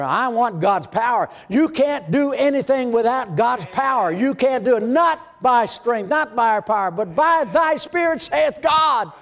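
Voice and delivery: high, slow, ringing sermon delivery; preaching at full force. Acted. Preacher proclaiming to the congregation, high and ringing.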